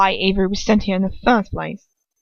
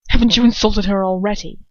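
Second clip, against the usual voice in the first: sharp, louder challenge to his father; angry retort; 7 dB louder than usual. Acted. Draco confronting his father, louder and angry. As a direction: throw the accusation back hard.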